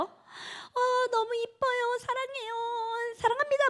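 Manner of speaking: high, gushing, adoring voice; mock sweet high-pitched praise; gushing high greeting. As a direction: coo it like an adoring fan. Mimicking the CEO's gushing greeting.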